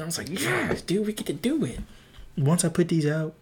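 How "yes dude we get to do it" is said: high-pitched excited outburst; thrilled, reliving the moment. Retold or imitated. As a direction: squeal it like you can't believe your luck. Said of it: "I was shaking".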